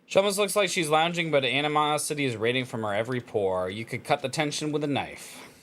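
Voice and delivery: story narration. Acted. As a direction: read it like a novel narrator. Reads visual novel text aloud.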